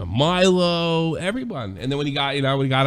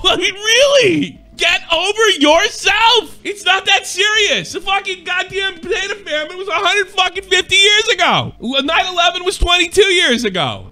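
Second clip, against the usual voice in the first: loud, higher, slow and incredulous; loud exasperated rant; 13 semitones higher, 5 dB louder than usual. Real, not acted. Ranting at a rival creator about the joke, loud and exasperated. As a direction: tear into him, slowing every word.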